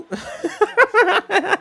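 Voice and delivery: high burst of laughter; high, loud real laughter. Real, not acted. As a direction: crack up laughing. Laughing at own writing.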